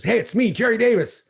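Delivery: hoping to be recognized; Jerry eager. Retold or imitated. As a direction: pitch it up like an eager fan. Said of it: "And he goes".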